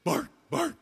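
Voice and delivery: high put-on bark as words; yappy high dog voice; joke dog barking. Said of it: "The dog says".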